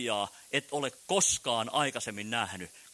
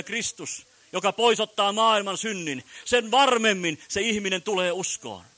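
higher and louder fervent sermon; full-force preaching; 5 semitones higher, 5 dB louder than usual. Acted. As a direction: preach it hard to the tent crowd.

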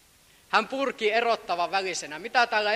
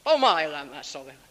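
normal talking voice

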